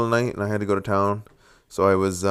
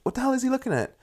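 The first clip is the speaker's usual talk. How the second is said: pitched way up, bewildered question; baffled; 14 semitones higher, 5 dB quieter, faster than usual. Retold or imitated. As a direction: react to a raccoon staring you down. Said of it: "I was like".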